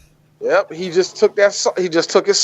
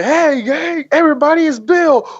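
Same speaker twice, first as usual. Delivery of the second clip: high-pitched rowdy greeting; mock hearty welcome-back cheer; excited welcome; 9 semitones higher than usual. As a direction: call it out high to a whole crowd. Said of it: "the second he showed it".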